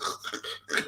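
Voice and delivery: demonstrated congested snorting breaths; mock snuffly, rasping breath; blocked-nose breathing. Shown as a demonstration. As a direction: imitate rough breathing through a blocked nose. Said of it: "I had a blocked up nose".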